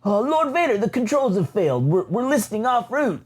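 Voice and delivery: high, panicky pilot voice; panicked pilot. Retold or imitated. The drill pilot reports to Vader in a high, panicky voice. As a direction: report the failure in a fluster. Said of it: "And he says".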